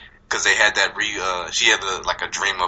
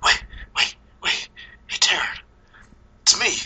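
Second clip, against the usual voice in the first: hushed, voiceless imitation of Freddy; whispered plea; 10 semitones higher than usual. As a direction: whisper it to calm her. Freddy calls to Taryn.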